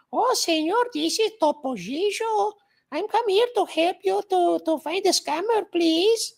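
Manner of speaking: put-on high-pitched mouse voice, Spanish accent; Topo Gigio. Host voicing Topo Gigio helping catch scammers, high mouse voice. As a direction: play the little puppet mouse offering help, way up high. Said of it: "with a Spanish accent".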